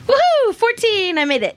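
high, happy whoop; excited cheer. Real, not acted. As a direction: cheer the successful roll. Player celebrates a good roll.

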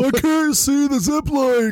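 high, slow, laughing mock old man. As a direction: whine it like a fussy old man. Mocking old man complaint.

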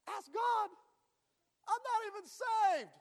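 high, loud mock disbelief; acted-out outraged squawk; incredulous protest. Retold shocked reply to mom.

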